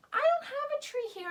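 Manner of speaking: bubbly little confession; playful aside. Real, not acted. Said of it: "playful aside".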